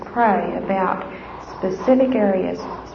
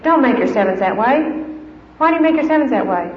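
higher, exasperated correction; scolding parent; 3 semitones higher, 4 dB louder than usual. Retold or imitated. Mother corrects her little boy's backwards sevens, scolding. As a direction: scold the child, pitch climbing on the question. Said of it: "you know what I say?".

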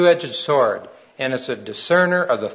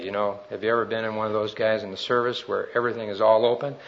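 ordinary talk, nothing marked

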